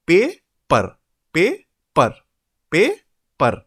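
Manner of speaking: paper split in two, pay, per, with a gap; loud separate beats on paper, first rising, second dropping; broken into syllables. Shown as a demonstration. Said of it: "We break it here".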